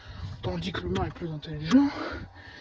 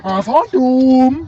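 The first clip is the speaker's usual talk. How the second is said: slow, loud spooked cry; drawn-out frightened wail; scared horror game streamer; 5 semitones higher, 10 dB louder, much slower than usual. Real, not acted.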